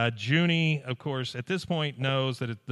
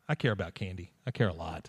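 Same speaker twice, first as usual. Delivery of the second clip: deadpan confession; 7 semitones lower, 6 dB quieter than usual. Retold or imitated. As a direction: lower and softer, sheepish admission. Bobby confessing, deadpan punchline.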